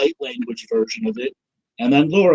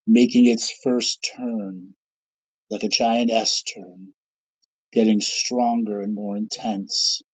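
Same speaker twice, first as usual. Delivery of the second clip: guided meditation; 3 semitones lower than usual. Acted. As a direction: ease them in, unhurried and soothing. Leads a guided visualization.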